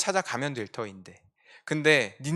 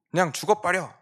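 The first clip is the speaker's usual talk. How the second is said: mock dismissal; 5 semitones higher, 4 dB louder than usual. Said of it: "제가 하나님이었다면 진작에 말했을 겁니다".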